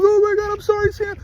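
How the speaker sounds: very high, mock-crying voice; imitated tearful apology. Owner leaving Sammy behind, imitated tearful apology. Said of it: "She's crying".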